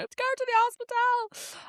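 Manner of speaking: very high, strained mock wail; mock crying. Retelling waking in pain, high mock-crying plea. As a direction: wail it as if in tears. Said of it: "And I'm crying".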